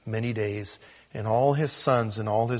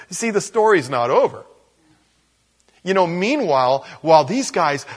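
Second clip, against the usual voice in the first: high, loud sermon build; impassioned preaching; 6 semitones higher, 8 dB louder than usual. Real, not acted. Pastor drives home Jacob's grief to the congregation, high and loud. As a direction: preach it with rising fire.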